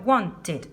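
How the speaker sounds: wanted as "wan-tid"; wanted with the -ed heard as "id"; ID ending. Shown as a demonstration. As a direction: slowly split wanted into two syllables.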